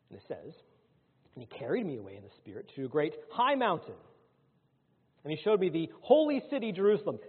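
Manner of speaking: high, loud proclamation of scripture; forceful preaching. Acted. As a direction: ring the verse out to the congregation. Preacher proclaims verses from Revelation.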